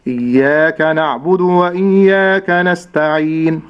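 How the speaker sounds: slow, measured tajweed recitation of إياك نعبد وإياك نستعين; slow Quranic recitation. Shown as a demonstration. Teacher models the verse for the student, slow and measured. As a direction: recite it slowly with careful tajweed. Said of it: "نكرر مرة أخرى".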